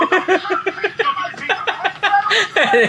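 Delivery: high burst of laughter. Real, not acted. Friends watch clip.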